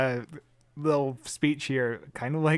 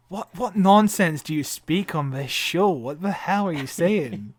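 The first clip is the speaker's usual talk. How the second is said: slow, louder, grandly theatrical outrage; Shakespearean actor; 3 semitones higher, 6 dB louder, slower than usual. Retold or imitated. John Hurt scorning the younger Doctors' jargon, slow theatrical boom. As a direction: declaim it like a stage actor scorning nonsense. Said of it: "like a Shakespearean actor being like".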